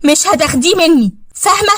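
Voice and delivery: high-pitched possessive threat; acted catfight anger; jealous fury. Rival attacks wife, hair pulling.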